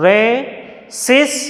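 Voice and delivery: Resistant drawn out slowly, SIS lifted; slow teacher demo of re-SIS-tant; stress on SIS. Shown as a demonstration. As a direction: stretch Resistant and hit its middle. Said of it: "stressed syllable we have to highlight".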